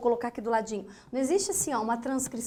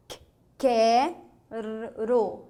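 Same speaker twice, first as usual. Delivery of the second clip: quero drawn out very slowly, sound by sound; teacher modeling quero slowly; slow demonstration; 2 semitones lower, much slower than usual. Shown as a demonstration. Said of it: "Ó".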